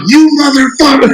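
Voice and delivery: high-pitched imitation of a furious mom; mock maternal fury; retold angry mom. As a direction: scold him as the outraged mother, high and hammed up. Voices angry mom on phone.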